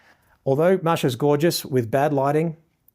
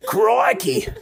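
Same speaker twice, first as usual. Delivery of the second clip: high-pitched, hammed-up Aussie exclamation; exaggerated Australian accent. Photographer joking about the Australian accent, hammed-up Aussie cry. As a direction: squeal it in broad mock Australian. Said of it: "not used to the Australian accent".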